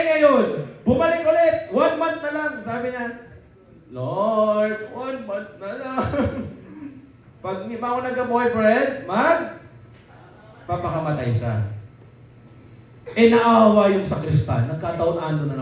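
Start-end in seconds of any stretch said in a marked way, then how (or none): 3.9-11.7 mock nasal prayer, laughing.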